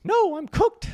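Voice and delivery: loud, very high mock Finnish accent; squeaky, exaggerated Finnish accent; Elsa's Finnish accent. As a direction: imitate a Finnish speaker in falsetto. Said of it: "In, like, her Finnish accent".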